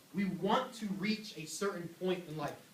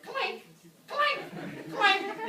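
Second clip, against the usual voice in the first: clank sound; 4 dB louder, much slower than usual. Retold or imitated. Imitating small weights clanking.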